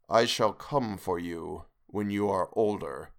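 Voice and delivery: low, slow, distant ghostly voice; ghostly face. Acted. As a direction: drag out each word like a phantom. Ghostly lips quoted in memory.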